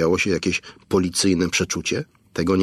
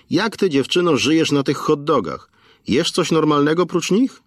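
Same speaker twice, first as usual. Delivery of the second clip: teasing shopkeeper; 8 semitones higher than usual. A narrator's reading. Station owner teases Aneta about hot dogs, high and lively. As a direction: tease her about her diet.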